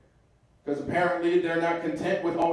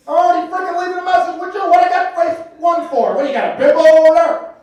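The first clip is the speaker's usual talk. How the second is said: much higher, louder indignant yell; irate uncle; 11 semitones higher, 9 dB louder than usual. Retold or imitated. Uncle yelling back at the voicemail recording, fast high and loud. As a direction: rage at the answering machine. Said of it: "Uncle Mike arguing with machine".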